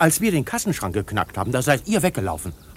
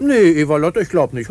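higher, louder hasty no; emphatic refusal; 5 semitones higher, 8 dB louder than usual. Acted. Crook hastily refuses to bring his partners along, loud. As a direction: cut her off quickly and loudly.

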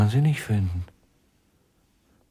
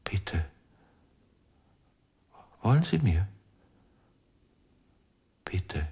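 whisper; 3 semitones lower, faster than usual. Acted. Broken man pleads faintly.

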